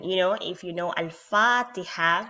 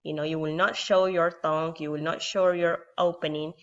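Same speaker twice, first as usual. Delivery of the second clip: ordinary talk, nothing marked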